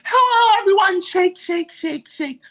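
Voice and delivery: very high mock performer squeal; loud high hello, tailing off; mock stage greeting. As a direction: mimic a singer hailing the crowd in falsetto. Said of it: "she's saying hi to the audience".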